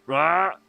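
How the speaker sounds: short mock animal roar; creature call imitation. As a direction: imitate a big beast's cry. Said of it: "they're the ones that go like".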